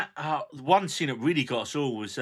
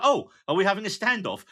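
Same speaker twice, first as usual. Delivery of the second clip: a hint of breezy, chirpy Fanny; lightly voiced Fanny; 6 semitones higher, 3 dB louder than usual. Fanny at the bar amid drawn guns, breezy. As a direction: ask it brightly, unbothered. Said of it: "she's like".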